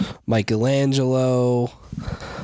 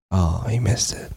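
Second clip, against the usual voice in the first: ordinary talk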